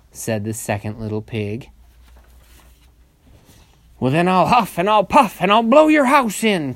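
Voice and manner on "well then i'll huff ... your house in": loud, raised storybook wolf threat; angry wolf cry. A narrator's reading. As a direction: bellow it as an angry old wolf. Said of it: "cried the angry old wolf".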